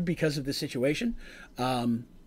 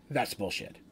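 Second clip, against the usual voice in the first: low, quiet, no-nonsense challenge; dead serious; 7 semitones lower, 10 dB quieter than usual. Acted. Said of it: "With just a dead serious face".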